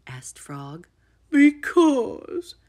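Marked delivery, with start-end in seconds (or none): 1.3-2.5 high, loud drawn wail. A narrator's reading. Toad explaining himself to Frog, wailing. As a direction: wail it in protest. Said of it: "wailed Toad".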